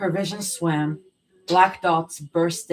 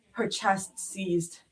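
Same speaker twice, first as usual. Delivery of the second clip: plain reading voice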